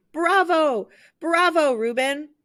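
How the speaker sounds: high, loud bravo shouts; cheering crowd. Acted. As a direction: cheer him from the seats.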